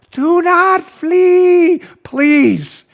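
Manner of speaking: high, drawn-out mock plea. Host reminding revolutionaries not to run, high drawn-out mock plea. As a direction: stretch it out as a hammed-up appeal. Mock appeal to fleeing foes.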